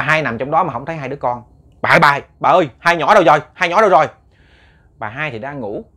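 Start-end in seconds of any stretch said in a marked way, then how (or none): 1.8-4.1 loud, panicked calling for his wife; frantic calling. Retold or imitated. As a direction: call out in alarm, louder each time. Husband searching for missing kids.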